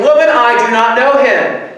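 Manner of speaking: loud, high, exasperated outburst; indignant yell, fending off an accuser; angry shouted denial. Acted. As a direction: shout the denial at full force. Peter's final, angriest denial, acted.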